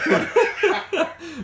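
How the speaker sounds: loud, high-pitched burst of laughter; real laugh. Real, not acted. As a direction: laugh out loud at being teased. Amused by the French accent jibe.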